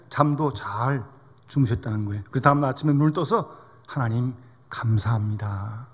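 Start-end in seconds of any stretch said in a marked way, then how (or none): 3.9-5.9 low, soft, slow thanks to God; thankful prayer. Retold or imitated. Grandmother thanks God on waking, gently grateful. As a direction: let the thanks out slowly and quietly.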